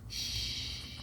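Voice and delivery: soft long shh; a gentle bedtime shush. A narrator's reading. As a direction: hush the sleeping monkeys. Monkeys asleep.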